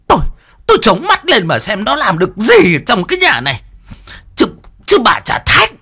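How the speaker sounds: high, loud furious shrieking; shrieking rage. A narrator's reading. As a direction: lash out at the husband. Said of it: "rít lên".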